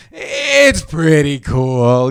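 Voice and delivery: very slow, drawn-out mimicry; mock reluctant admission. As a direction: drag it out like a reluctant concession. Mimicking media slowly coming round.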